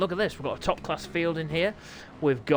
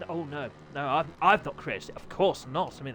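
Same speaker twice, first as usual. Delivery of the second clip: usual speaking voice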